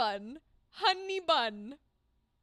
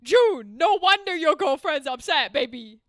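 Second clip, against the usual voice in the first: scolding June; 9 dB louder than usual. Retold or imitated. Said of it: "I'm like".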